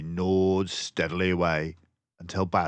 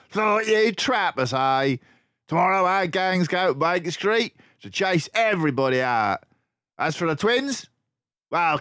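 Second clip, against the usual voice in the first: high, loud villain voice; gloating crook; 8 semitones higher, 5 dB louder than usual. Acted. Crook boss ordering sailors to take prisoners. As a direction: bark the orders.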